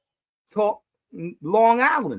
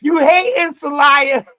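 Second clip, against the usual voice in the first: loud, higher taunt at a friend; teasing shout; 7 semitones higher, 10 dB louder, slower than usual. Real, not acted. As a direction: razz him loudly.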